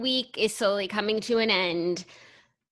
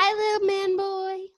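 put-on baby talk; 9 semitones higher than usual. Cooing at dog.

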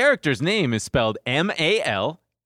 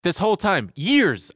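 normal talking voice

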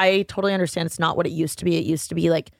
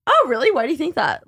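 mock surprise; 7 semitones higher than usual. Acted comeback to condescending remark.

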